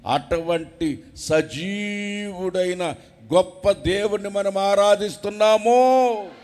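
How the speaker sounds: loud, rising sermon shout; full-force preaching. Acted. Preacher shouting to the congregation, fiery and loud.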